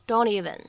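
"don't even" run together as one word; "don't even" with no gap between the words; linked DON'T EVEN. Shown as a demonstration. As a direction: link the n-t of "don't" straight into "even". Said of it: "去做一个连读".

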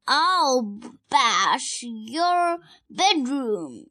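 loud, very slow, drawn-out threat; shouted threat. Acted. Child reading a twin's threat, shouting slowly. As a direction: stretch each word out loudly.

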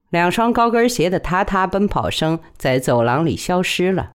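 ordinary delivery, nothing marked

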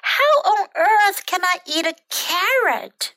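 high, exasperated story-book shout. A narrator's reading. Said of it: "shouted bird".

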